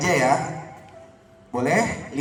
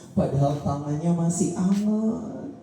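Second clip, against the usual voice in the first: leading a prayer aloud. Shown as a demonstration. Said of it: "Saya tadi ngomong itu biar kamu ikutin". (doa yang dipimpin dengan lantang dan khusyuk)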